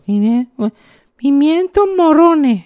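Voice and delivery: put-on very high, slow, struggling child voice; a tiny boy fumbling the hard words; little kid mispronouncing. Little boy to his mother, high and unsure. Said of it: "yo no casi ni hablaba".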